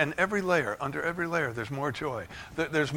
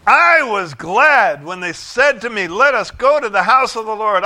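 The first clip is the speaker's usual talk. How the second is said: joyful proclamation; 4 semitones higher, 10 dB louder than usual. Real, not acted. Reading psalm with joy.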